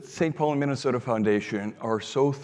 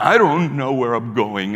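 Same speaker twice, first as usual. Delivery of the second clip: upset reply; 2 semitones higher, 9 dB louder than usual. Retold or imitated. Upset young man answering Frank.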